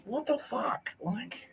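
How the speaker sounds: high, slow, disbelieving, trailing to a whisper; incredulous. Real, not acted. Co-host learns someone works at darpa, stunned disbelief. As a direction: pitch shoots up in real disbelief.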